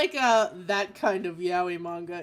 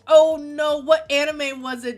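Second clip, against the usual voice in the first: alarmed oh no; 6 semitones higher, 12 dB louder than usual. Real, not acted. Reacting to the anime shirt.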